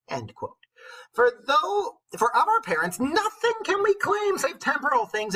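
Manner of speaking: storybook witch voice declaiming Chaucer. Acted. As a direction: recite it like a wise old witch. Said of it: "go back into my witch voice".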